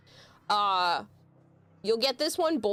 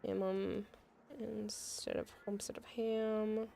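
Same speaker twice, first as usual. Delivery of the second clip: whispered aside; 11 dB quieter than usual. Real, not acted. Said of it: "whispered aside".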